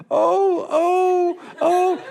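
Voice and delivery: high, crying whimpers; cowering whimper. Acted. Driver hiding behind his handkerchief, high crying whimpers.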